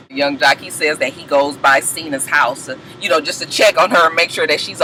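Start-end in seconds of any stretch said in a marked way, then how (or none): none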